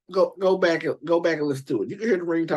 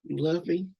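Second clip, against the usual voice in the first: softer, coaxing pimp plea; smooth pimp voice; 4 semitones higher, 5 dB quieter than usual. Retold or imitated. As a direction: sweet-talk her so she won't leave. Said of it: "Ring like".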